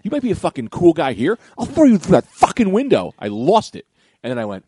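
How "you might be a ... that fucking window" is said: threat building higher and louder; retold angry snap. Customer threatens rude store clerk, re-enacted angry outburst. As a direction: re-enact losing it, rising to a peak. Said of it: "I snapped".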